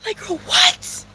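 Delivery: whispered, slow disbelief; whispering. Real, not acted. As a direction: breathe it out in a whisper. Whispered aside.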